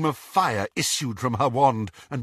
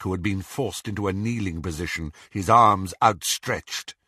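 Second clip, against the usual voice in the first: plain reading voice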